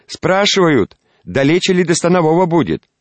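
loud high shout. Acted. Shouting into old man's ear.

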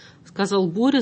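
ordinary delivery

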